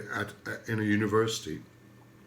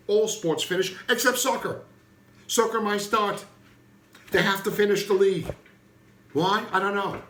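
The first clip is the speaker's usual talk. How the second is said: exasperated; 9 semitones higher, 4 dB louder than usual. Real, not acted. Raised pitch exasperated listing.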